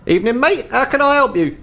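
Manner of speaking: high-pitched elf shopkeeper voice; tall elf shopkeeper. Acted. Tall elf greets a customer entering the hunting shop, high-pitched. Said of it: "you're met by a very tall elf".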